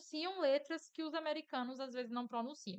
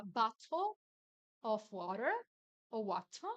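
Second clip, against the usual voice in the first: British accent demo; slower than usual. Shown as a demonstration. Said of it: "você vai ter dois sotaques".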